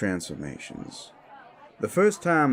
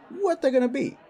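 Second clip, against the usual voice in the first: pitched very high; exasperated worry; faster than usual. Acted. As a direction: let the voice shoot up in exasperation. Admits not knowing, exasperated.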